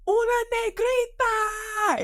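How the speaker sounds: put-on high, slow shouted call of a little boy; kid's shout. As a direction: yell it out like a startled child pointing. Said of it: "yelled it at the top of his lungs".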